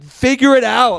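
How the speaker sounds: high-pitched mimicry of a snappy server; impatient shop woman. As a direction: imitate her losing patience with him. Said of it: "couldn't order fast enough".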